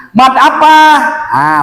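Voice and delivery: ordinary talk, nothing marked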